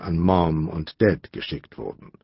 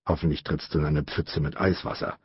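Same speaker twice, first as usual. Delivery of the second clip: low, soft angry grumble; 5 semitones lower, 6 dB quieter than usual. A narrator's reading. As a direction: grumble it under the breath. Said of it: "brummte Luther wütend". (leise wütend gebrummt)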